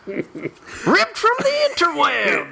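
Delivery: put-on high, slow old prospector. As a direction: drawl it like a gold miner. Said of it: "I'm so awesome at that accent".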